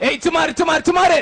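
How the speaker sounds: shouted street call; loud call. Acted. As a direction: bellow the name across the room. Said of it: "எதுக்குடா சத்தம் போடுற?". (சத்தமான கூவல்)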